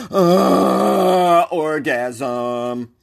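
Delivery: loud, drawn-out moan of pleasure; swooning over the dish, exaggerated; mock ecstatic moan. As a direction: moan in hammed-up food ecstasy. Mock ecstasy over food description.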